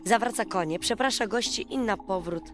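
ordinary delivery, nothing marked

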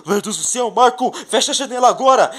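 high, loud, fast urgent order; alarmed cry at the bombs; panicked shout. Pretend. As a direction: yell to shut the window. Bombs arriving.

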